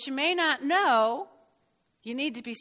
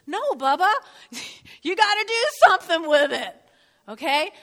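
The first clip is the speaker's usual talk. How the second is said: louder, higher, chuckling; laughing scold; 4 semitones higher, 6 dB louder than usual. Real, not acted. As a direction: tease them with a laugh. Teasing correction with a laugh.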